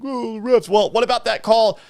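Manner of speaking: high, mocking whine of a pundit; mock whiny pundit. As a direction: sneer it like a sore TV analyst. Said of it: "Saint Tony Dungy was like".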